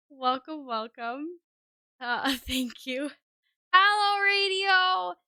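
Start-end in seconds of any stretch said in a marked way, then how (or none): 3.7-5.1 much higher, louder hello; excited greeting. Real, not acted. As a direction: sing out a bright hello. Greeting viewer.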